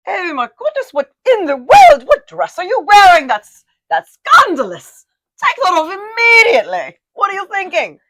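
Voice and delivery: hammed-up British accent telling someone off; mock British accent. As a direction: scold yourself like a horrified British lady, British accent. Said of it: "I make sure to speak in an accent".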